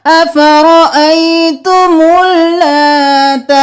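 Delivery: slow, chanted tajweed recitation; Quranic recitation. Shown as a demonstration. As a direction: chant it slowly, stretching the vowels. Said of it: "यहाँ पर अलिफ और इस्लाम को नहीं पढ़ेंगे".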